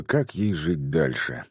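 ordinary delivery, nothing marked

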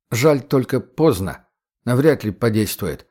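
ordinary delivery

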